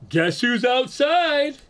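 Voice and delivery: high, slow, teasing singsong; drawn-out coy tease, pleased with himself; playful sing-song tease. Retold or imitated. Retelling texting his ex from outside her house, slow high teasing singsong.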